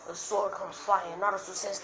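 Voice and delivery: ordinary delivery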